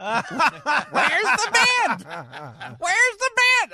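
very high, slow squawk through laughter; Clara Peller flub. Retold or imitated. Old lady repeats her botched line, high laughing squawk. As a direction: screech it out, laughing as you go. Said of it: "She goes".

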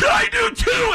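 very high hammed-up tearful wail; mock crying. Fan answers his sports hero, mock crying. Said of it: "And I started crying".

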